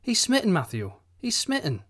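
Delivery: high, emphatic cry of he's smitten; insistent wife. A narrator's reading. Wife urging Matthew, son is smitten, high emphatic. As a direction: press it home to her husband, voice up high.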